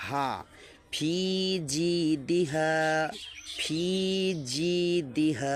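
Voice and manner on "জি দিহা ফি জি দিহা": জি said pressed and clear, slowly; pressed jim. Shown as a demonstration. Teacher showing the clean জি to students. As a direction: press the জি, don't mix it with জা. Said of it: "জি চেপে".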